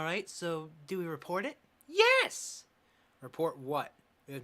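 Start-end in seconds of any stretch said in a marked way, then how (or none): 1.9-2.6 a sudden high, loud yes; eager shout. Acted. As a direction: blurt it out, bursting with eagerness. Eager yes to reporting abuse.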